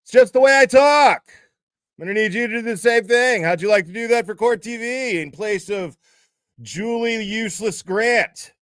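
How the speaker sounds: loud, high, brash anchor voice; mock host yelling over the phone. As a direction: belt it like a shouty TV host. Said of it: "You don't have to yell at me".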